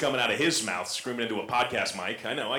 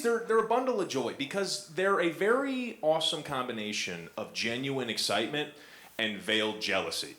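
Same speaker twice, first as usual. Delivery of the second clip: normal talking voice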